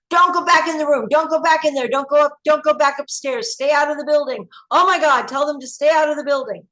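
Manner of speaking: high, loud frantic pleading; screaming at the TV. Retold or imitated. Shouting at the TV as people reenter, frantic high pleas. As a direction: beg the people on screen to stay out. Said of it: "we were screaming at our T V screens".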